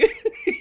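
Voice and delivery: quick high-pitched burst of laughter; short high laugh. Real, not acted. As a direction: laugh at own joke. Laughs after joke.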